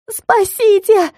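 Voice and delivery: high, drawn-out cry for rescue; acted howl of panic. A narrator's reading. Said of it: "взвыла я".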